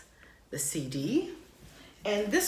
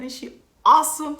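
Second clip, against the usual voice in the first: louder and higher, bursting; excited exclaim; 9 semitones higher, 11 dB louder than usual. Real, not acted. Fan shouting her admiration for the artist. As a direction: call it out proudly. Loud repeat.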